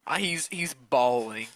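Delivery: ordinary delivery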